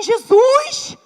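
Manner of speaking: high, loud exasperated cry; exasperated sigh to Jesus. Retold or imitated. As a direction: call on Jesus in disbelief. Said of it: "Fazia assim".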